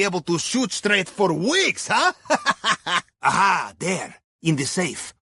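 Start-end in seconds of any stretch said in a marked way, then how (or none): 2.2-3.0 a quick pleased laugh; brief amused laugh; amused chuckle. Acted. Amused aside after joke.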